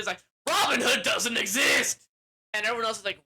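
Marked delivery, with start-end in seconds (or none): 0.5-2.1 high, loud mock outrage; offended shout. Outlaw leader offended by a story request, shouting. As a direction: shout it like a personal insult. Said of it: "the leader gets all offended".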